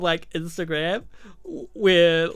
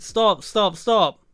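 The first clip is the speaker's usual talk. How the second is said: retold yell to stop; 5 semitones higher, 6 dB louder, much slower than usual. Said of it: "she's like".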